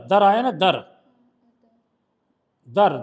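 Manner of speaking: normal talking voice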